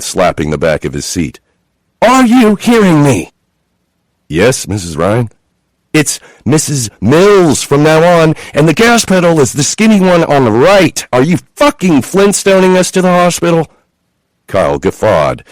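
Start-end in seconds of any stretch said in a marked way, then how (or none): 2.0-13.7 high, loud furious scolding; yelling at driver. A narrator's reading. Chloe yelling at the driver to speed up, loud. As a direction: bark orders at the driver.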